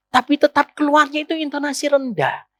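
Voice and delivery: normal talking voice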